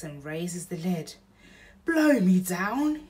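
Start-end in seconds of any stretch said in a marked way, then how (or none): 1.8-3.1 slow, loud amazed exclamation; hammed-up burglar gasp of surprise; astonished. Bill surprised by baby.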